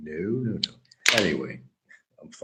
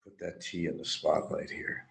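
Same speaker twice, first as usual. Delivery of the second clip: ordinary talk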